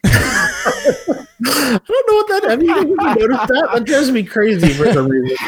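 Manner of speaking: laughing. Real, not acted. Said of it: "laughing at cohost's fake accent".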